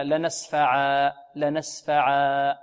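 "لنسفعا" slow, ending in long aa; stopping on alif. Shown as a demonstration. Teacher shows how to pause here, "لنسفعا" slow with open long ending. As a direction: draw out "لنسفعا" and stop without an n. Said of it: "نقف عليها هكذا".

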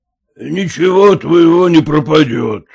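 ordinary delivery, nothing marked

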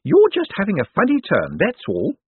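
a fussing carer covering her fear; nervous nurse. A narrator's reading. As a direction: Miss Drapes fussing over her patient, high and jittery. Nurse soothing.

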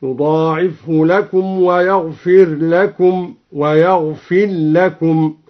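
يضاعفه لكم ويغفر لكم recited slowly, the ra of ويغفر merged then shown; slow Quranic recitation with merged and clear R. Shown as a demonstration. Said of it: "قرأ بالإدغام والإظهار في الراء هكذا". (ترتيل بطيء بالإدغام والإظهار في الراء)

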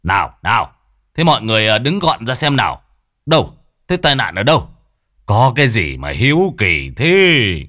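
high, loud orders to the onlookers; crowd-clearing command. Acted. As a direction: push through the gawking crowd. Long parting the crowd.

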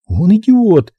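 high-pitched insult; high, blunt contempt; contemptuous captive woman. A narrator's reading.

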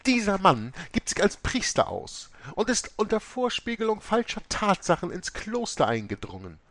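high, loud accusation; accusing abbess. A narrator's reading. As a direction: denounce him to the police, high. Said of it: "Mutter Oberste sagte".

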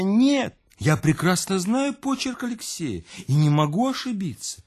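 high, insistent denial; anxious self-reassurance. Acted. Louise insisting to the narrator, high and anxious. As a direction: reassure herself, voice pitched high. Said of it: "как бы стараясь успокоить самую себя".

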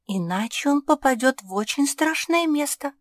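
high, loud pug voice; explaining pug. A narrator's reading. Pug explaining the cat's danger to the crow, high voice. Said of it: "пояснила Жози".

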